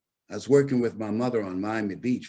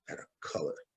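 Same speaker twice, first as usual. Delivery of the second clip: hushed, unvoiced aside; whispered slur; 13 dB quieter, faster than usual. Employer whispering the slur to the boy. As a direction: drop the word to a whisper.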